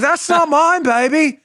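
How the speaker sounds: high, swinging mock Austin Powers voice. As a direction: play the groovy spy denying it all. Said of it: "And he's like".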